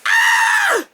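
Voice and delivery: high, loud shriek of dismay; shocked shriek. Retold or imitated. Climbers spotting the elevators, loud high shriek. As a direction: yelp as if betrayed.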